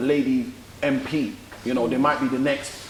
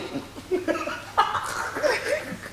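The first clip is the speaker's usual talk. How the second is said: high breathless giggle; tipsy giggle, breathy and high; 6 dB quieter than usual. Real, not acted. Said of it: "I'm a bit tipsy".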